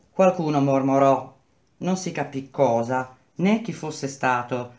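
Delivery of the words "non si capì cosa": plain reading voice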